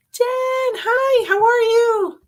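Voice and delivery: high, bright hello to a viewer; cheery welcome to a live viewer; excited greeting. Real, not acted. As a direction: greet her with a lift of delight.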